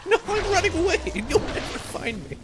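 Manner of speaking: whiny son voice. Pretend. Son protests to his dad in a high, fast whine. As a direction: whine it like a teen refusing his father.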